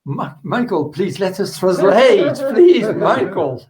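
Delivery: high, laughing plea to stop; exasperated but amused begging, pitch jumping up; playful pleading. Real, not acted. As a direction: beg with a laugh, stretching the words out. Interpreter begs speaker to pause.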